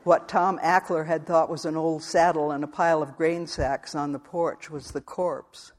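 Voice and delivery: ordinary delivery, nothing marked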